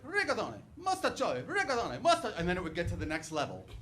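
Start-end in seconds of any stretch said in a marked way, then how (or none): 0.0-2.3 higher, "Rigatone, mostaccioli" in Italian vowels; Italian pasta names. Retold or imitated. Grandma arguing with mom, Italian pasta-name pronunciation. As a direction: bicker over pasta names. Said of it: "My grandma would be like".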